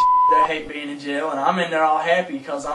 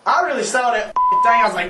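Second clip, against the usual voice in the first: ordinary delivery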